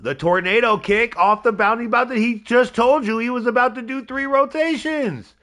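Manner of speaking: high, amazed match call; excited play-by-play. Real, not acted. Streamer calling a tornado kick the wrestler promised, high and thrilled. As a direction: pitch it high, amazed at the move.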